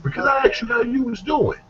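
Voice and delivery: mock-innocent excuse. Offender defending himself after stabbing, high innocent excuse. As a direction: plead the case in a high voice.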